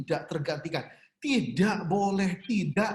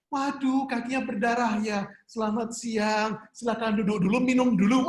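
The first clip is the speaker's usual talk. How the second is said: higher and louder, a sugary hospital welcome; mock-polite receptionist, pitched up and loud; overly polite ER greeter; 6 semitones higher, 4 dB louder than usual. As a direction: greet the bleeding patient like a chirpy host. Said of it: "Anda bawa pasien ke U G D".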